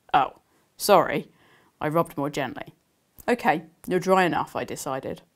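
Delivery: reading her novel aloud to listeners; gentle storytelling read; story narration. Acted. Author reading her book to the audience, storytelling.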